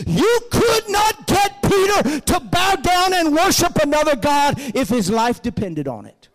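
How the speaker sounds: loud, emphatic pulpit cry; full-force preaching. Real, not acted. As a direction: belt it out, each word hammered.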